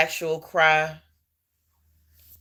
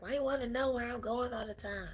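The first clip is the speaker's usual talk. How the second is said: mock-snappy comeback, pitched higher and softer; snappy teen retort; 10 semitones higher, 11 dB quieter, faster than usual. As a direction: toss it back like a moody teenager. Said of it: "always be a little snappy and be like".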